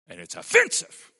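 high and loud indignation; indignant preaching shout. Real, not acted. Preacher to congregation, high loud indignant outburst. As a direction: let them feel the offence.

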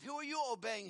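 ordinary talk

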